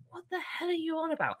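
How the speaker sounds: high mock outrage; mock exasperated mentor. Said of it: "And she goes".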